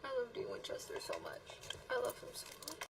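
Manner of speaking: soft, half-whispered adoration; tiny, emotional murmur; overwhelmed, hushed affection. Real, not acted. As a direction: say it quietly, choked by feeling. Emotional after the scene.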